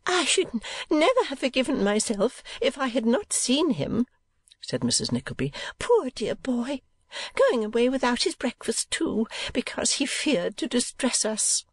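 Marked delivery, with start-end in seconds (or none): 0.1-4.1 high-pitched voice of an anxious mother; fretful mother. Acted. Mother embracing her departing son, high fond voice. 5.8-11.6 doting mother. Acted. Voices Mrs Nickleby.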